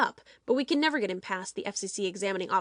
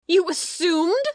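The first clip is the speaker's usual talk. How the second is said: loud incredulous yell, pitched up; outraged shout; 8 semitones higher, 8 dB louder, slower than usual. Acted. Producer shouts at the young doctor in disbelief. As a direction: blast the question back at him.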